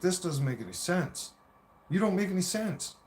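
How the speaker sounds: exasperated retort. Retold or imitated. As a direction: argue back in a retold quarrel. Acted argument with his partner.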